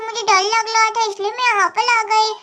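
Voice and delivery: usual speaking voice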